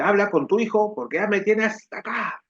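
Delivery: a fed-up mother demanding; mom's demand. Retold or imitated. (madre harta que exige, con voz más aguda)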